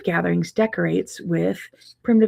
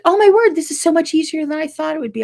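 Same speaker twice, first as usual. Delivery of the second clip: much higher, louder, quick thrilled gasp; delighted surprise; 10 semitones higher, 7 dB louder, faster than usual. Retold or imitated. Timid student finding the class easy, squealing with joy. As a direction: gush like a student amazed it was easy. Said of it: "she was like".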